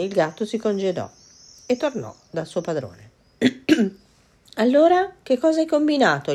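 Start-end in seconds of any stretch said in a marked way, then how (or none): 4.6-6.3 put-on high, loud eager boy voice; the boy pressing for news, pitched up high; curious young lad, voiced high and loud; young boy asking. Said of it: "gli chiese il ragazzo".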